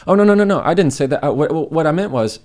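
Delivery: high, loud, panicked backpedalling denial; flustered backpedal. Acted. Flustered person backpedaling fast.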